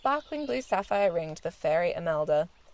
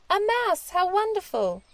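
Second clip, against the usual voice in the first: high, bright cry of delight; delighted exclamation; 11 semitones higher than usual. Acted. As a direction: gasp out the joy at finding the mouse. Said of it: "the princess said out loud".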